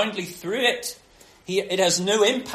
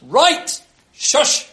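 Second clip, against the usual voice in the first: sharp, loud, high-pitched scolding; telling off; 8 semitones higher, 9 dB louder than usual. Acted. Jesus telling the raging storm off, sharp and loud. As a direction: cut the noise dead with a loud hush. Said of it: "by telling the storm off".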